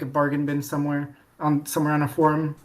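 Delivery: usual speaking voice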